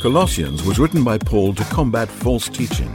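normal talking voice

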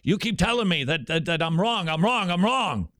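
a hint of an irritated stranger's complaint; imitating annoyed man. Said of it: "he's getting really annoyed".

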